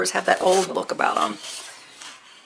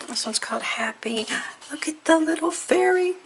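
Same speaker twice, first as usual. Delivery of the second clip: whispered reveal; 8 semitones higher than usual. Real, not acted. Paper haul, hushed reveal.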